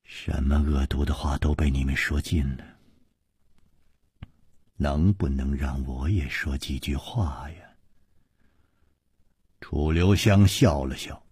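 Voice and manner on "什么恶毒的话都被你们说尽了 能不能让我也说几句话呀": low, quiet, wounded plea; subdued, hurt appeal with a touch of tremor; trembling voice. A narrator's reading. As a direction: plead softly, holding back emotion. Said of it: "她颤声说".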